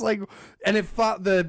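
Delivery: ordinary delivery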